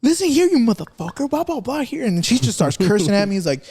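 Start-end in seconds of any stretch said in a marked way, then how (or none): 0.0-2.0 high-pitched mocking imitation of a furious woman; mock angry woman. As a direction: shriek it like the angry lady cursing at you. Angry woman quoted cursing at him.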